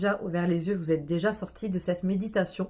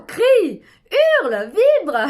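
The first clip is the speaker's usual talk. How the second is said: much higher, louder cries; shouting; 12 semitones higher, 10 dB louder, slower than usual. Shown as a demonstration. Guide shouting at her listeners to scream, loud yell. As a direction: yell the commands out. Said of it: "je vais le faire avec vous".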